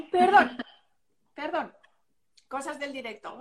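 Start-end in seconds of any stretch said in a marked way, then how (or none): none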